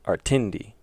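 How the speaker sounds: Artindi stressed in the middle, ar-TIN-di; stress on second syllable. Shown as a demonstration. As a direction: put the weight on TIN in Artindi. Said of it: "if it was on the second one".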